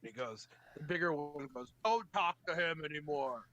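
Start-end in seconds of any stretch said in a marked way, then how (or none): 1.8-3.4 lightly voiced bossy captor; a hint of a brute giving orders; stern order. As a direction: bigger captor orders the others to stop talking. Bigger captor orders others.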